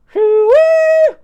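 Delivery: very high, very loud pig squeal; screamed squeal. A narrator's reading. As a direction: scream a pig squeal with all your force. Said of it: "Barnaby screamed".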